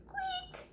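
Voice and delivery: tiny high mouse squeak; a quick squeaky mouse noise. Acted. Squeaking like a tiny mouse hidden in the house.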